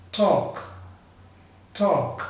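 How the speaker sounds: "Talk" ending in an unvoiced K; voiceless final K. Shown as a demonstration. Teacher giving another example, "talk" with voiceless K. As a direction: say "talk" with a K free of vibration. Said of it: "Não tem vibração também".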